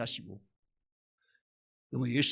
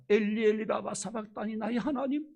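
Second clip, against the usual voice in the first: rising, higher anguished cry to God; 8 semitones higher than usual. Retold or imitated. Jesus on the cross crying out to the Father, rising anguished cry. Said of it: "그것도 얼마 컸던지".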